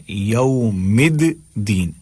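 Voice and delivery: "الدین" broken as mid, then deen; split double daal. Shown as a demonstration. Teacher showing two letters, split doubled d. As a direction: say "یوم الدین" slowly, sounding both d's apart. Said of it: "دو دال ہیں".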